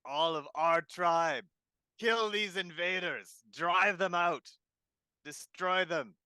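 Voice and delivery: loud battle cry to the tribe; rally the goblins with a roar; yelling. Acted. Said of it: "So I'm gonna yell out then".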